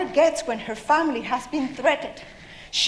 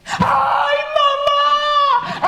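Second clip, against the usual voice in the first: very high, loud, drawn-out shriek; terrified scream; 13 semitones higher, 6 dB louder, much slower than usual. Acted. Mice find the cat right in front of them, terrified scream. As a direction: scream for mama in terror.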